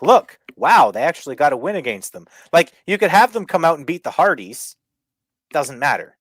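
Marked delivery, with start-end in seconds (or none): 0.0-2.3 excited. Retold or imitated. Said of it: "then be like".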